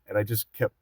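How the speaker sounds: ordinary delivery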